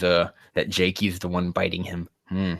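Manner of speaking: ordinary talk, nothing marked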